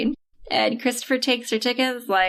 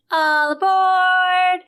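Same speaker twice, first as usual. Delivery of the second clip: slow, drawn-out, louder train call; conductor call; 7 semitones higher, 4 dB louder, much slower than usual. Retold or imitated. Conductor announcing the train's departure, booming slow. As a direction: stretch it like a grand conductor. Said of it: "Christopher Lloyd is like".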